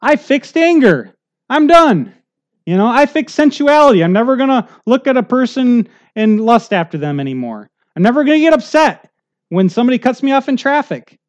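high, loud mock declaration of victory; mock triumphant boast. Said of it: "This isn't gonna be like".

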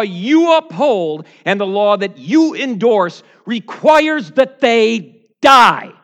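very loud, high courtroom accusation; accusing prosecutor. Acted. Devil as prosecutor accusing the defendant before the judge, thundering. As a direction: thunder the charge, stretching out the final demand. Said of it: "points across the courtroom and says to the judge".

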